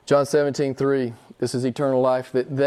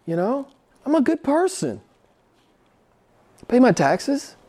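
high, smug self-justifying voice; mock the proud churchgoer pleading his case; mocking self-righteous person; 5 semitones higher than usual. Preacher mimics a self-righteous person bragging to God. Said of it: "They say, they say".

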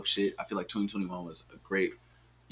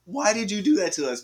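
high-pitched comic complaint; mock reproach; 10 semitones higher than usual. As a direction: plead in playful exasperation. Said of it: "I wanna look at her like".

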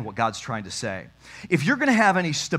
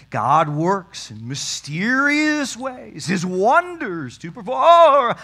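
slow, lofty, much higher churchy intoning; pious TV preacher voice; 7 semitones higher, 6 dB louder, much slower than usual. Retold or imitated. Preacher mocking how Christians talk about God's will, lofty and slow. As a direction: ham up a sanctimonious televangelist. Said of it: "why are we talking like this".

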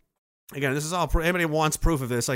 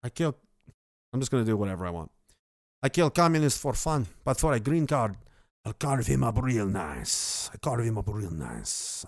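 Tony Montana; 4 semitones lower than usual. Shown as a demonstration. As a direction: do a dangerous cartel guy. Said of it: "That's the voice I was doing".

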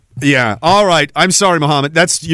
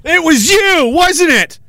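mock outraged yell; 12 semitones higher than usual. Streamer acting out reading a jar note, mock outraged high shout. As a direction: yell the accusation at the chatter, half joking. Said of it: "I'd be like".

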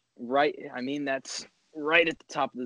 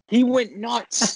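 excited; 9 semitones higher, 6 dB louder, much slower than usual. Retold or imitated. Retelling wild home run celebration.

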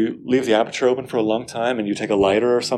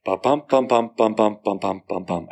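steady ba-bum pulse, da-DUM da-DUM; even alternating bum stresses; regular iambic beat. Shown as a demonstration. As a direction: beat out a clean iambic meter. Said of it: "very regular pattern".

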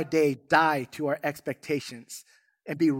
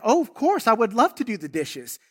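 forced eager yes; 5 semitones higher, 4 dB louder than usual. Retold or imitated. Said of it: "he went and".

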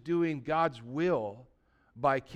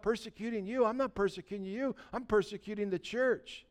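higher, softer protesting voice; puzzled inner thought; 3 semitones higher, 7 dB quieter than usual. Retold or imitated. Preacher voices Saul thinking to himself, softly puzzled. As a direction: think aloud in hushed, mock bewilderment.

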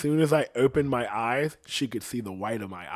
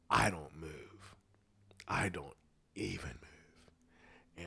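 very slow, soft whisper; hiding motionless in the closet; 6 semitones lower, 11 dB quieter, slower than usual. Retold or imitated. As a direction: barely breathe the words. Hiding from mom.

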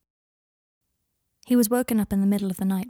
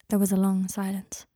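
ordinary delivery, nothing marked

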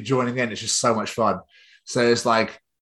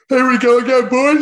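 higher and louder, pumped up; rallying call; 12 semitones higher, 8 dB louder than usual. Real, not acted. Host kicking off the show, loud and pumped.

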